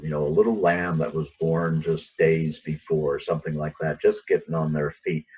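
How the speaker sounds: usual speaking voice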